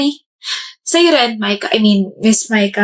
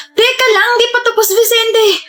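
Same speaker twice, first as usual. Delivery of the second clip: high call; 12 semitones higher than usual. Acted. Girl calls out to Vicente.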